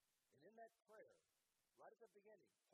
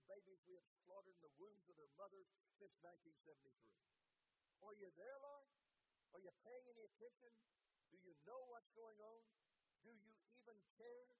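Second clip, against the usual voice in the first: raised, pleading prayer that demands answers from God; impassioned prayer; 3 semitones higher, 3 dB louder than usual. Acted. Preacher praying aloud, demanding God act, impassioned pleading. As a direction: cry out to God in frustration, louder. Preacher voicing an angry lament.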